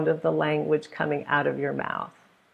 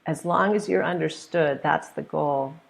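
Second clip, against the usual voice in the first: ordinary talk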